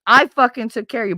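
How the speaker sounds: loud, fast, furious; fast loud outburst; angry. Real, not acted. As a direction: throw it at him, fast and loud. Ranting at ex-husband.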